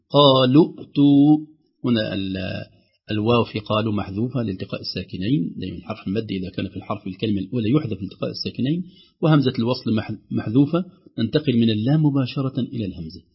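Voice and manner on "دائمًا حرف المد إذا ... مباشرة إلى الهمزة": normal talking voice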